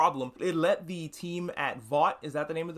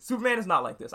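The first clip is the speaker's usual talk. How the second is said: ordinary talk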